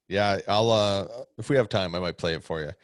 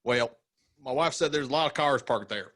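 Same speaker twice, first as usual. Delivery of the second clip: high Southern twang; Southern accent; 5 semitones higher than usual. Acted. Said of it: "the accent that you did".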